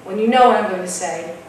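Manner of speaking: ordinary delivery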